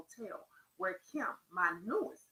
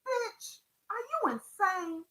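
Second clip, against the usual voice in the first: acted menace, pitched high and drawn out; mock deadly voice; 11 semitones higher, 4 dB louder, slower than usual. A narrator's reading. Pimp confronting his defiant woman, high-pitched acted deadly menace. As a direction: play the threat high, stretching out the disbelief. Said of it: "said in a low, deadly voice".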